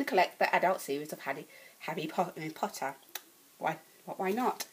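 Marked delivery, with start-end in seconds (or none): none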